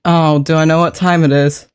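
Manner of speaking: ordinary talk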